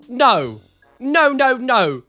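ordinary talk, nothing marked

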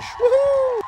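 high gleeful cheer; excited whoop. Real, not acted. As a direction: whoop with joy. Dealer change celebration.

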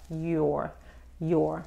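"your" with lips circled for the final R; rounded R. Shown as a demonstration. Teacher repeating for learners, "your" with a rounded-lip American R. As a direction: end "your" on an American R, tongue free. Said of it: "hacer un círculo con los labios".

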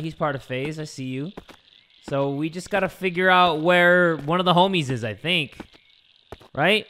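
ordinary delivery

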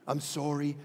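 low, soft, meek apology; mild-mannered Canadian. Acted. Preacher mocking a polite Canadian, low and monotone. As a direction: apologize blandly and quietly. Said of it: "That's Canadian".

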